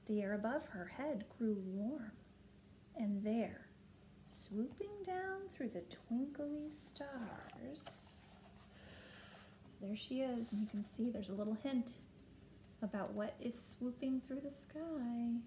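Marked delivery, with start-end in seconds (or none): none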